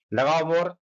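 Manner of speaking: ordinary talk, nothing marked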